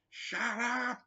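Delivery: high, loud shout down from upstairs; imitated yell. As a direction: yell it like a fed-up neighbour. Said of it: "used to yell down in there".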